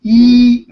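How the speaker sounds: "Yi" as a loud, long "ee" on a high level tone; Y left silent. Shown as a demonstration. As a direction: hold "Yi" as a pure "ee", no Y. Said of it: "just ignore the Y".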